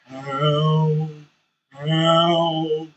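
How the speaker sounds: normal talking voice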